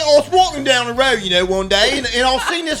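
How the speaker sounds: a goofy fake English gent telling a tale; over-the-top mock English accent; bad English accent. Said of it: "do it in an English accent".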